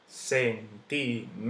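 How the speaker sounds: centímetro split as cen-tí-me-tro; syllable by syllable. Shown as a demonstration. Teacher counting syllables for students, slow, separated syllables. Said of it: "última sílaba".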